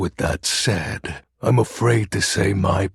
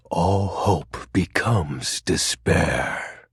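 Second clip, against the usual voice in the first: usual speaking voice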